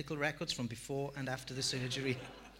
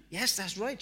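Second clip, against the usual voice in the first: tearful and pressed; 7 semitones higher, 6 dB louder than usual. Retold or imitated. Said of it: "she pressed out through her tears".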